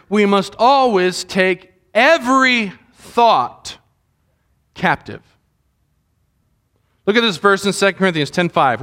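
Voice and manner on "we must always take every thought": slow, deliberate weight on "always"; emphatic ALWAYS. Real, not acted. Pastor warning the congregation about the enemy, slow stress on "always". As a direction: stretch "always" and keep each following word slow and weighty. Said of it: "I cannot emphasize the word always enough".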